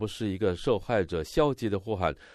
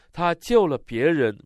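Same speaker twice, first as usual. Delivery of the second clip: higher, louder mock shout; crowd's shout; 7 semitones higher, 6 dB louder than usual. Interpreter voicing the crowd taunting Jesus, a raised shout. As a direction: call it out like a jeering onlooker. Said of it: "他们大声喊着说".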